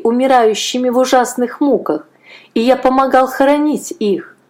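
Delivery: put-on a brave boy refusing to give in; defiant boy. A narrator's reading. Boy defies the soldier.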